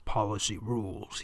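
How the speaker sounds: soft, low pronouncement; hushed, solemn statement; said softly. A narrator's reading. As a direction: say it quietly and low, with weight. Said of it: "he says softly".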